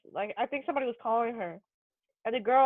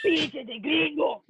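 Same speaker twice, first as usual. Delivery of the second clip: shouted Mexican Spanish; 4 semitones higher, much slower than usual. Retold or imitated. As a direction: yell it like a Narcos villain in Mexican Spanish. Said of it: "That's what they say".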